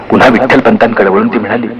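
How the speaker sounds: plain reading voice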